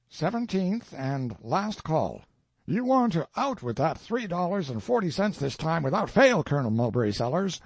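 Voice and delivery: brusque, insistent bill collector; curt demand. A narrator's reading. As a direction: demand the money curtly, no patience. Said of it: "with the curt remark".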